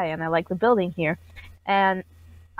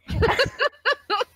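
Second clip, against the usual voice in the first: high giggle; 14 semitones higher than usual. Real, not acted. Laughs after own quote.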